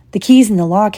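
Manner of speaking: usual speaking voice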